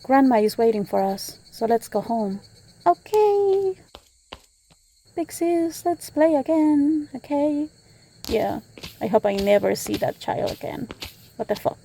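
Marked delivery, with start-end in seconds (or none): 2.8-7.7 put-on high, childlike and eager; sweet kid asking to play; cheerful little girl. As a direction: daughter asks big sis to play again, childlike. Little girl cheerfully to big sis.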